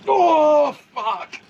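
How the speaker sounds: sudden high yelp then curse; startled cry. Real, not acted. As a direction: yelp as the rod slams down. Rod yanked, sudden cry.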